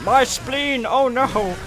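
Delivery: high, hammed-up dying henchman; mock enemy death cry. Player mimics the enemies he kills, melodramatic shouting. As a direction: wail theatrically as a doomed villain. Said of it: "shout out".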